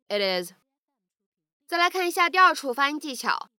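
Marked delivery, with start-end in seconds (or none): 0.1-0.5 "it is" run together with a flapped t; American flap T joining "it is" into "ih-diz"; linked "it is". Shown as a demonstration. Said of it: "连读可以读成".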